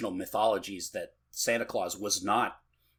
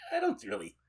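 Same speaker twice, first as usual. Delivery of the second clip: put-on doubtful kid; 10 semitones higher than usual. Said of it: "where kids are like".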